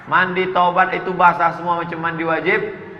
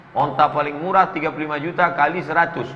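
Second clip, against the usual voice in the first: normal talking voice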